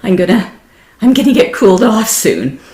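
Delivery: high, laughing and eager; hot traveller nearly at the oasis, excited; eager excitement. Pretend. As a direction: bubble over with anticipation. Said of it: "that sort of excited feeling is piti".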